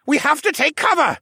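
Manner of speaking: loud, high warning shout; urgent order. A narrator's reading. Jenner warning Alex to take cover, shouted order. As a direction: command them to safety. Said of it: "he ordered".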